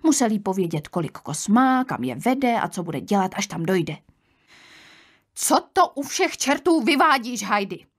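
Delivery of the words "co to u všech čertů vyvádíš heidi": high-pitched, understated shriek; shrieked scolding. A narrator's reading. Aunt finds the girl undressed, shrieking reproach at moderate volume. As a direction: scold the child in a raised, high voice. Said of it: "zaječila Detty".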